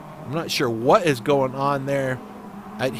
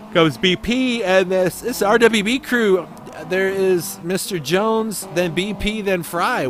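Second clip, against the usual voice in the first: play-by-play; 4 semitones higher, slower than usual. Acted. Live play-by-play calls.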